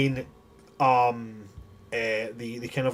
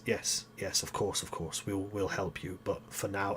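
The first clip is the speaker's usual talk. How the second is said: hushed, soothing false promise; sly whisper; 5 semitones lower, 13 dB quieter than usual. Acted. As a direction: whisper the lie softly into his ear. NPC lies before stabbing man.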